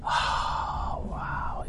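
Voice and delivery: breathless hushed "oh wow"; mock awe. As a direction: imitate an impressed crowd, barely voiced. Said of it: "everybody else in the room is going".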